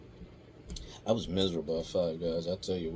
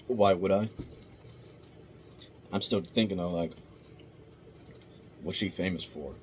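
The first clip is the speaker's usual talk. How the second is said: ordinary delivery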